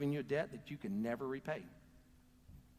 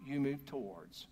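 normal talking voice

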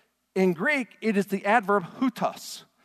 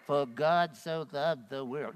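slow, soft and dull, no feeling at all; flat, lifeless reading; 5 semitones lower, 7 dB quieter, slower than usual. Shown as a demonstration. Pastor mocking a limp congregation reading, dull. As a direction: read it like it bores you. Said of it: "Don't read it".

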